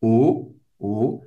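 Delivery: a lone closed "O"; isolated, rounded French "O"; O sound. Shown as a demonstration. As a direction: say "O" by itself as a sound demo. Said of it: "tem o som do O".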